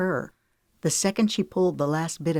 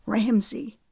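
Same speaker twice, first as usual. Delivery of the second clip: tearful; 6 semitones higher than usual. A narrator's reading. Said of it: "Her eyes pulled with tears".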